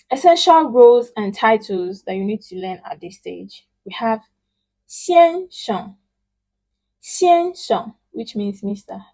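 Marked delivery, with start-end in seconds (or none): 4.9-7.9 "先生" said high and level; model word. Shown as a demonstration. Teacher drilling titles with students, high and clear. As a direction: hold "先生" high and even. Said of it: "Please pronounce it".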